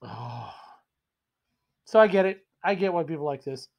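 normal talking voice

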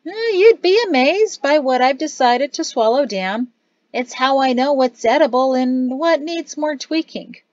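an eager cook who tastes everything; cook character voice. Acted. Cook Gazada tells friends his tasting habit, lightly voiced.

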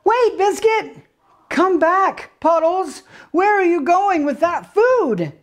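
put-on high, loud little-girl call after the puppies. Girl calling the puppies back from the food, high loud call. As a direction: shout after the runaway dogs in a high voice.

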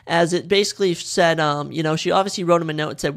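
ordinary talk

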